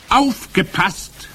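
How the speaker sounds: high, drawn-out witch command; calling out. A narrator's reading. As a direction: call the crowd to attention, slowly. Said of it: "rief die Hoch".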